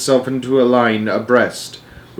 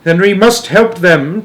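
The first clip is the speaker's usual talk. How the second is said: higher, drawn-out plea; urgent cry; 7 semitones higher, 4 dB louder than usual. A narrator's reading. Boy urging Wonka to rescue them, crying out. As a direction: cry out to help. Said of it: "cried Charlie".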